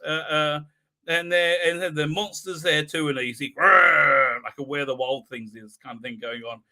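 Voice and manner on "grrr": loud mock monster growl. Imitating the monster.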